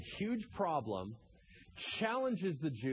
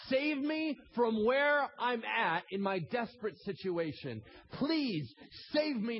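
desperate plea; 8 semitones higher, 4 dB louder, slower than usual. Acted.

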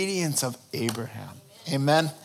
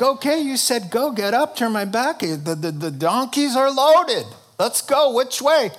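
eager Abraham; 8 semitones higher, 4 dB louder than usual. Acted. Preacher acts eager Abraham.

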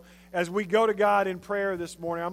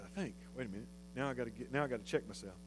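low, much softer aside to himself; muttered aside; 8 semitones lower, 16 dB quieter, much faster than usual. Real, not acted. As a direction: drop the voice right down, as if checking privately.

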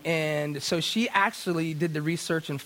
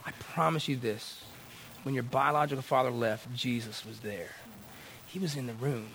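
soft, lowered, solemn promise; quiet reassurance; 4 semitones lower, 6 dB quieter than usual. Retold or imitated. Counselor assuring a hurting client, quiet and solemn. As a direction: lower and soften it, pausing between phrases.

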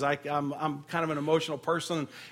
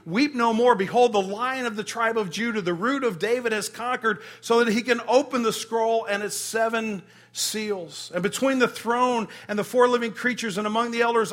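higher, louder, triumphant proclamation; proclaiming; 4 semitones higher, 4 dB louder than usual. Acted. Reading the elder's victory cry to the church, proclaiming. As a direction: announce it like a herald.

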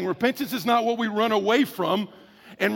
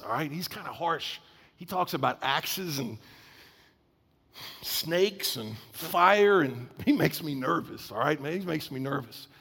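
low, softer voice, nervously confiding; uneasy hushed complaint; 4 semitones lower, 6 dB quieter than usual. Retold or imitated. Listeners uneasy about harsh John, speaking in a hushed voice. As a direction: hush it like John gives you the willies.

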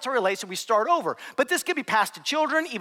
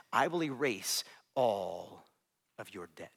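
tender forgiveness; 10 dB quieter, slower than usual. Acted. Master forgiving the debt.

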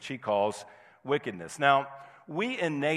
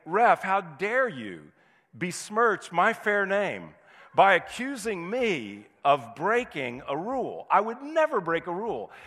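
mock offended player; 6 semitones higher than usual. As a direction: play wounded innocence to the ref. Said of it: "as if to say".

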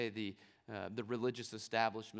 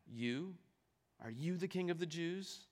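lightly voiced, looking down, higher on the question; a hint of a governor sneering from his seat; condescending; 6 semitones higher than usual. Pilate questioning Jesus from his seat, mildly condescending. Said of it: "picture him saying it rather condescendingly".

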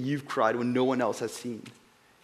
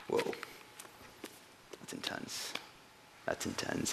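awed whisper; 17 dB quieter than usual. Real, not acted. Awed reaction after reading.

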